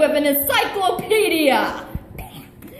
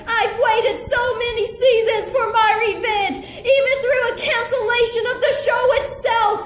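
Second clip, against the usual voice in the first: frantic rant; 5 semitones higher, faster than usual. Acted. Villain's fast desperate rant.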